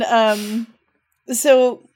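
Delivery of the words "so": ordinary talk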